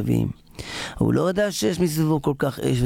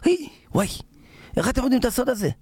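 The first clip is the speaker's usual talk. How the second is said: high startled yelp into a question; acted alarm, pitched way up; shocked king; 11 semitones higher, much faster than usual. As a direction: gasp out your astonishment. King shocked his secret is known.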